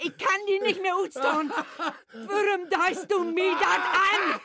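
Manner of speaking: breaking down. Acted. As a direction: crack under the strain, sobbing. Mother breaks down, laughing and crying.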